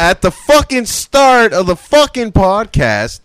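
loud, high, drawn-out outburst; exasperated shout. Real, not acted. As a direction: hammer each stressed word slowly, shouting. Exasperated answer in an argument.

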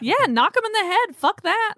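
high, loud, fired-up outburst; loud, high-pitched dismissal; fired-up order. Acted. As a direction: call for the blow with gusto. Player eagerly orders a rifle blow.

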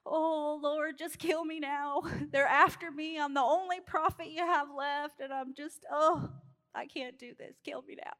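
mock-despairing high whine; scared prophet. Elijah hiding in a cave pleads with God, scared whine. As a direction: moan it like a hunted man giving up, fading off. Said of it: "he runs off scared".

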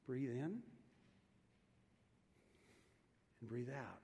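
fading hushed breathing cue. Shown as a demonstration. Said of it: "something I want to practice with you".

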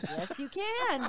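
drawn-out, laughing yes; gleeful acceptance. Retold or imitated. Seller agreeing to a buyer's two-day request, gleeful. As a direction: stretch it out through a delighted laugh.

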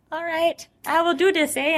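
put-on playful Canadian accent with eh; lightly voiced Canadian intern.

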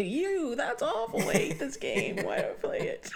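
high, hammed-up grumbling; mock sore loser. Gamer who has just been killed, mock despair. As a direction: wail it in comic defeat, half laughing. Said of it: "then they kill you".

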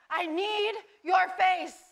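loud, slow desperate plea; shouting the plea slowly from the dream; desperate cry. Retold or imitated. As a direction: cry it out like your last hope. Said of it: "all that I could muster out of my mouth was".

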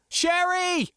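high, urgent yell; yelled over comm. A narrator's reading. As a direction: yell her name down the comm. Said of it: "I yelled over comm".

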